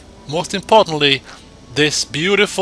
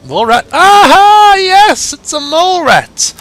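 high, loud excited yelps; giddy high-pitched cheer; thrilled shriek; 11 dB louder than usual. Real, not acted. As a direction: squeal with glee at the find. Spots a mole rat, thrilled.

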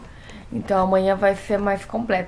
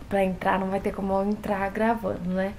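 ordinary talk

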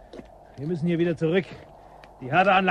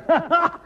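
high mocking laugh; 11 semitones higher than usual. Guide laughs at her.

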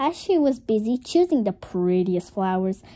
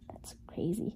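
whisper; 4 semitones lower, 13 dB quieter than usual. Real, not acted. Whispered aside during story.

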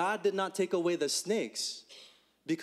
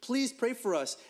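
higher, begging voice; pleading people; 6 semitones higher than usual. Acted. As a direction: plead with Moses for help. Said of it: "The people say to Moses".

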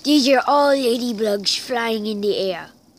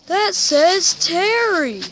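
higher, louder, slower amazement; amazed; 8 semitones higher, 6 dB louder, slower than usual. Real, not acted. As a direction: stretch out the name in wonder. Repeated, more amazed.

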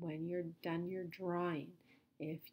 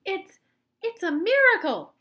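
high, loud, halting amazement; gasped; 12 semitones higher, 14 dB louder than usual. A narrator's reading. Grandpa Joe marvelling at the miracle, gasped. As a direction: break after the first word, then exclaim high. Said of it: "gasped Grandpa Joe".

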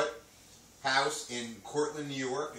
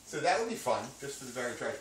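ordinary talk

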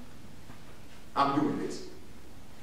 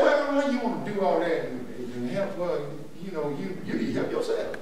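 softer, sly tempter muttering doubts; a quiet needling voice, mocking the helper; sneering devil; 6 semitones lower, 9 dB quieter than usual. Preacher voicing the devil mocking a helper, softer and sly. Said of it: "That's how he talks".

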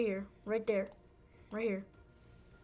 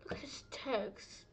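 whisper; 8 dB quieter than usual. Real, not acted. Muttering at a glitched player's text.

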